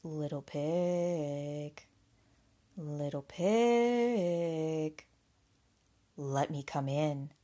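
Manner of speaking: low, drawn-out wolf call; big bad wolf. A narrator's reading. Wolf at the pig's door, low drawn-out voice. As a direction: stretch the words out long and low. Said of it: "along came the wolf and said".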